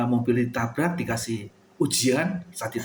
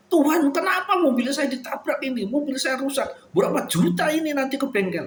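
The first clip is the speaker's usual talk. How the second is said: high-pitched indignant whining at God; complaining to God; 12 semitones higher than usual. Retold or imitated. A driver complaining to God about his dented car, high indignant whine. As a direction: play the aggrieved car owner blaming heaven. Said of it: "nyalahkan Allah lah".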